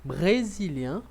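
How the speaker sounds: brésiliens, slow, loud, nasal ending; brésiliens drawn out for the class to repeat; model for repeating. Shown as a demonstration. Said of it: "Vamos repetir comigo".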